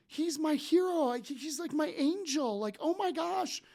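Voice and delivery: gushing fan. Retold or imitated. Stranger at the table gushing over the speaker on stage, high starstruck squeal. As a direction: squeal like you've spotted your idol. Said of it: "She's like".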